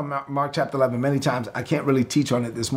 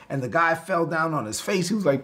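normal talking voice

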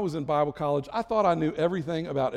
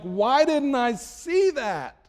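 slow, higher, drawn-out lament; hindsight regret; 8 semitones higher, 3 dB louder, much slower than usual. Retold or imitated. Looking back on a mistake, slow regretful lament. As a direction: marvel at your own blindness. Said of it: "we say things like".

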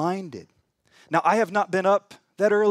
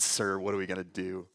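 lower, much softer, flat mock version; deadpan mock servant; 11 semitones lower, 9 dB quieter than usual. Shown as a demonstration. Preacher scoffs at the translation, low and soft. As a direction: mutter it low to show how unlikely it sounds. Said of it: "There's no way he said".

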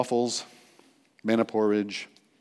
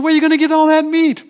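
high, loud incredulous squawk; mock Moses, unable to believe it; doubting Moses; 13 semitones higher, 11 dB louder, faster than usual. Preacher voicing Moses doubting God, high incredulous yelp. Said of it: "and Moses says".

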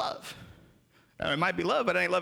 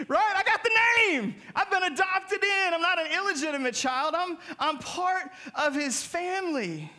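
exultant praise; 9 semitones higher than usual. Real, not acted. Preacher rejoicing before the church, high and jubilant. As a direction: celebrate being in God's family.

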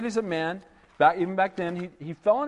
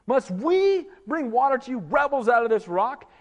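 "we" lifted high and stressed in a rising question; 6 semitones higher than usual. Retold or imitated. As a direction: stress "we", claiming credit angrily. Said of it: "taking some of the glory".

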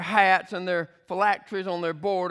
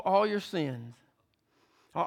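ordinary delivery

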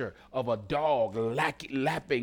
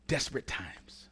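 whispered for emphasis, much softer; dramatic whisper; 2 semitones lower, 8 dB quieter than usual. Real, not acted. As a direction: drop to a hushed whisper. Dramatic hush on key phrase.

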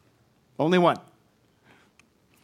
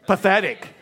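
high, loud scornful punch; disdain; 6 semitones higher, 8 dB louder than usual. Shown as a demonstration. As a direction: hurl it out after a pause. Repeating the shouted disdainful word.

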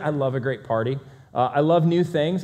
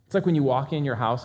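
ordinary delivery

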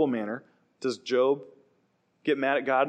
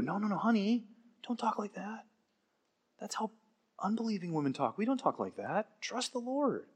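soft, higher-pitched reassuring voice; gentle correction; 7 semitones higher, 8 dB quieter than usual. Acted. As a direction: gently hush a loved one. Job gently corrects his wife.

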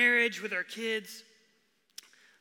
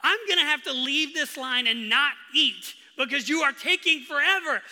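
loud, raised-pitch retold exasperation; frustrated; 5 semitones higher, 11 dB louder than usual. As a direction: complain loudly at whoever is holding up the line. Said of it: "I'm getting a little frustrated".

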